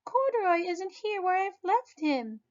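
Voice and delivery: put-on high girlish voice, upset; high child exclaiming bear missing; exclaiming little girl.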